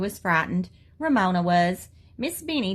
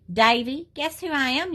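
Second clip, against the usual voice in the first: yelling; 6 semitones higher than usual. A narrator's reading. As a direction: call across the playground. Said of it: "yelled Ramona".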